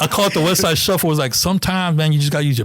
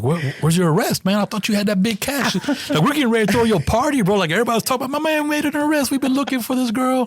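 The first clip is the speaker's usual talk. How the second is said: higher-pitched imitation of a teasing sergeant; mock ribbing; 9 semitones higher than usual. Sergeant teasing officer over the lost arrest, mock ribbing. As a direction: rib the rookie in a raised voice. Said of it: "she gave me some shit".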